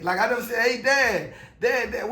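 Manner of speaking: ordinary talk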